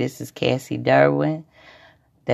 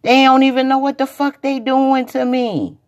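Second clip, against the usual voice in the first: impassioned plea; 11 semitones higher, 5 dB louder than usual. Retold or imitated. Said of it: "and he said".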